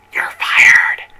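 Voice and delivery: loud, hissed stage whisper; mock stage whisper. Manager's code word to staff, a mock stage whisper. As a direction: whisper it like a dreaded verdict. Boss's dreaded code phrase.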